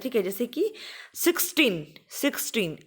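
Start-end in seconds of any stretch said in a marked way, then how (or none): 1.1-2.8 sixteen with six and टीन both weighted, falling at the end; stress on both syllables. Shown as a demonstration. Teacher showing double stress to students, both syllables stressed. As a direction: give six and टीन each a beat. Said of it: "दोनों ही syllables के ऊपर".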